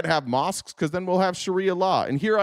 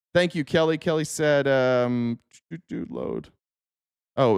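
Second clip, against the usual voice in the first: normal talking voice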